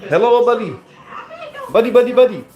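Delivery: high-pitched put-on Indian accent. Streamer greeting a buddy in character, Indian accent. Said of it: "Do an Indian accent?".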